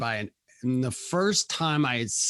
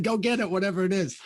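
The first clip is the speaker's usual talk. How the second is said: urgent shout; 6 semitones higher, faster than usual. Retold or imitated. Dad sends dog to basement.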